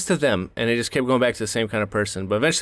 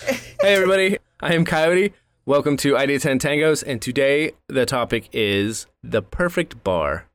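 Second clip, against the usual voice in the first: energetic show host greeting; podcast intro; 3 semitones higher than usual. Acted.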